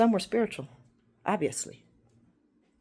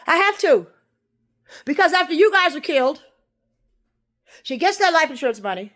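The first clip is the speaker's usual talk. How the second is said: loud warning; 6 semitones higher, 11 dB louder than usual. Real, not acted. Loud warning about plot.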